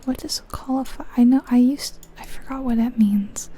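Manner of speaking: usual speaking voice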